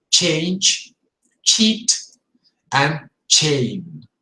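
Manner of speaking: ch in change, cheat, chain; crisp ch on each word; strong ch words. Shown as a demonstration. Teacher drilling ch words. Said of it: "the sound is a little bit stronger".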